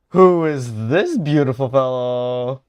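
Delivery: very loud, delighted exclamation; gushing delight. Real, not acted. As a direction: gush loudly at the sight of him. Gushing over a returning character.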